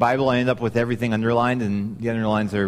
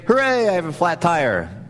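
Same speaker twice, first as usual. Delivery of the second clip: hammed-up fake cheer, higher and louder on hooray; mock cheery hooray; 5 semitones higher, 4 dB louder than usual. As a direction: pretend to be thrilled about a flat tire. Mock cheer over flat tire.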